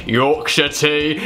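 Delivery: badly faked, drawn-out Yorkshire accent; hammed-up attempt at a Yorkshire accent; terrible Yorkshire accent. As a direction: slip into a slow, clumsy Yorkshire accent. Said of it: "that was a terrible accent".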